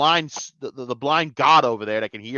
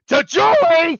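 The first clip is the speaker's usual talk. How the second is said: high, loud, drawn-out yell; yelled toast; 8 dB louder, slower than usual. Acted. Reviewer acting out a toast for the hell of it, yelled. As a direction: yell the name out, stretched and high. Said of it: "I really wanted to yell Julie".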